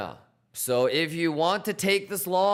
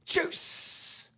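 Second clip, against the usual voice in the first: whisper; 13 semitones higher, 8 dB quieter than usual. Acted. Whispered catchphrase.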